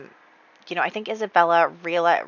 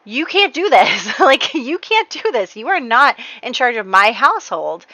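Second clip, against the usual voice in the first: higher, mock-outraged defiance; acted indignant protest; 5 semitones higher, 4 dB louder than usual. Son telling his mother off over his household, hammed-up outrage. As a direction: play up the outrage, pitch jumping up.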